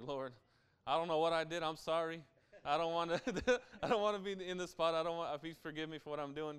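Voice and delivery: hammed-up begging for forgiveness; urgent plea. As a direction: act out a frantic apology, breaking briefly into a laugh. Acted plea to the Lord.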